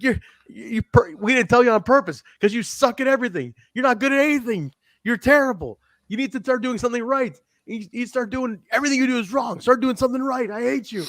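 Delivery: high-pitched, hammed-up scolding; mock berating. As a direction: tear into a hapless colleague in a put-on high voice. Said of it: "he's like".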